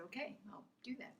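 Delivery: tiny whispered agreement; meek compliance. Retold or imitated. Employee obeying her boss's order, meek whisper. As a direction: whisper it obediently, very small.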